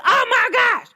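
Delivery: high excited shout; geeked-up greeting. Retold or imitated. Cousin spotting her in the club, high excited shout. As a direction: burst out thrilled to see her. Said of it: "he be that geeked up".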